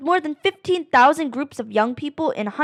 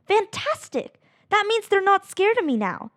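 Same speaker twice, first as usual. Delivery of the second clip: delighted; 8 semitones higher than usual. Acted. Delighted the chimp took food.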